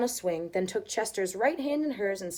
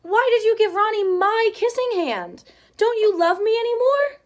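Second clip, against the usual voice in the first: high, loud pleading hurt; hurt child; 12 semitones higher, 10 dB louder than usual. Acted. Chester asks his mother if she still loves him, high loud hurt voice. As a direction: demand an answer, close to tears.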